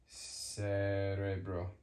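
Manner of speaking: "cerebro" drawn out slowly from a long s; lingering s opening "cerebro"; soft c. Shown as a demonstration. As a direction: say "cerebro" as sss-eh-REH-bro, slowly. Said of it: "sounds like letter".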